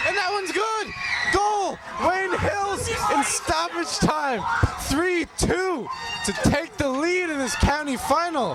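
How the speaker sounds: huge high excited goal shout; long goal yell. Real, not acted. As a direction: scream the goal call. Live goal call, score in stoppage.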